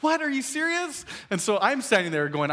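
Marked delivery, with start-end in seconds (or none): 0.0-1.1 high-pitched mock disbelief; exaggerated disbelief, voice leaping way up; incredulous. Dad to his picky son, incredulous high retort.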